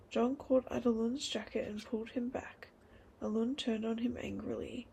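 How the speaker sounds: ordinary delivery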